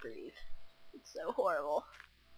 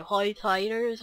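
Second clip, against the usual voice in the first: slow, louder Ocracoke brogue with OY for long I; Ocracoke accent; 5 dB louder, slower than usual. Shown as a demonstration. Out-of-practice vlogger impersonating islanders, slow Ocracoke accent. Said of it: "trying to impersonate them".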